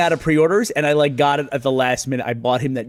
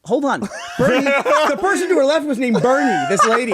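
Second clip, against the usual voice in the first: laughing while retelling; 11 semitones higher, 4 dB louder than usual. Real, not acted. Lady calling coworker, laughing.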